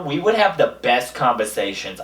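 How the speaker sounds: ordinary talk, nothing marked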